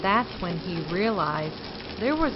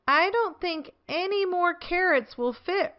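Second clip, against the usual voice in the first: Squirrel in a much higher voice; worried little squirrel; 8 semitones higher than usual. A narrator's reading.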